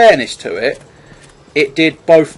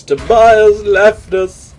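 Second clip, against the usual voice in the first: mock mourning; 8 semitones higher, 5 dB louder, much slower than usual. Mock mourning a departed teammate.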